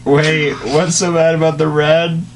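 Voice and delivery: ordinary delivery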